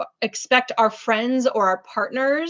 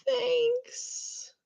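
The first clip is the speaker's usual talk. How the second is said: mock thanks; 13 semitones higher, 8 dB quieter than usual. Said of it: "I'm like".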